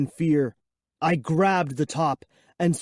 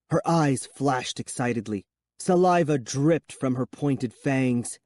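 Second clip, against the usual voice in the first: ordinary delivery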